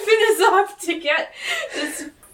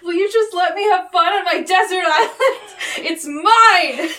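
loud, upset whine building to a shout; mock meltdown; 3 semitones higher, 7 dB louder than usual. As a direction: act out a tantrum, louder on the last words. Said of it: "[name] has a meltdown".

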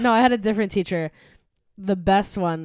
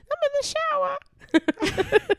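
much higher, slow mock falsetto; teacher's silly phone voice; much slower than usual. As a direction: drag it out in a goofy high teacher voice. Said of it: "and he'd be like".

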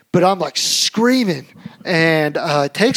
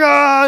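high loud alarmed cry; panicked shriek; 10 semitones higher, 9 dB louder than usual. Retold or imitated. Dad grabbing toddler, loud high shriek. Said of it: "So I'm like".

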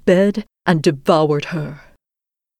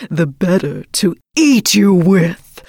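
slow, sinister wolf; menacing wolf voice; 3 dB louder, slower than usual. Acted. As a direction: draw it out like a hungry wolf. Wolf reveals himself.

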